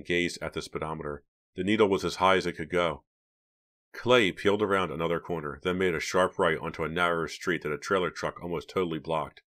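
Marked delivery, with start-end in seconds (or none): none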